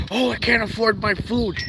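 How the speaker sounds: high, mocking whiny complainer voice; sneering impression of a complaining viewer; mocking whine. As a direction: parody the people who whine about prices. Mocking viewers who complain about prices.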